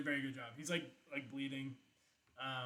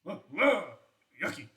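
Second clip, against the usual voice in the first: disgusted tiny man; 8 dB louder than usual. Acted. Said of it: "he's just like".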